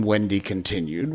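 usual speaking voice

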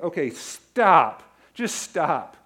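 loud, slow fed-up order to stop; a frustrated dad halting a fight; exasperated stop. Retold or imitated. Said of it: "It was so frustrating".

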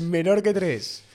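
lectura robótica. Shown as a demonstration. Imita la lectura de Twitch.

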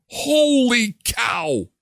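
slow, high, drawn-out holy cow; mock shock. Host reacting to seven-dollar water, drawn-out mock shock. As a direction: stretch it out in disbelief.

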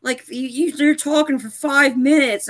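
high mock yell, not full volume; retold screaming. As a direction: urge the TV character out, imitating a scream. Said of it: "I was screaming on my T V".

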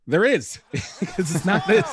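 laughing while half shouting. Real, not acted. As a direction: laugh through the punchline. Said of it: "laughing at own pick".

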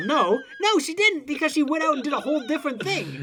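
high, fast, laughing objection; animated protest. Real, not acted. Correcting a co-host about the picket line, high animated protest. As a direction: jump in high and insistent, amused.

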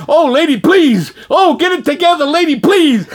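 loud, high comic begging; hammed-up desperate plea; panicked pleading. Man pleads with lady crying on him, panicked pleading.

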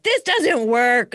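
loud, high, drawn-out cry; exasperated protest. Retold or imitated. Grieving student of law of attraction, loud exasperated protest. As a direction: protest it slowly at full voice.

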